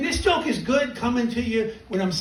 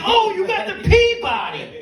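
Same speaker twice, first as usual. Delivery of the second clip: high mock-impressed sarcasm; father mocking his son's award, high and sarcastic; 12 semitones higher than usual. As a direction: pretend to be thrilled, pitched way up. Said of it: "I'm like".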